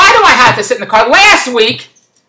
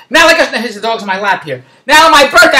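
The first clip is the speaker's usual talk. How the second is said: usual speaking voice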